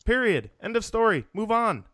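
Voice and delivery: very high-pitched, exasperated short bursts; soapbox rant. Pretend. Host telling critics to let it go, exasperated soapbox rant. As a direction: punch out each verdict high, falling at the end. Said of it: "Billy Soapbox".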